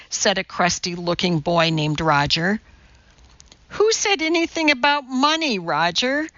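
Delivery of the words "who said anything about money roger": high-pitched exasperated question; restrained shriek, dropping on the name. A narrator's reading. Teacher rebuffs Roger's talk of money, shrieking. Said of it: "Missus Mudford shrieked".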